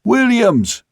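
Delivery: very high, held-out holler; straining high hail aloft. A narrator's reading. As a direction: call the name at the top of the range. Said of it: "more loudly".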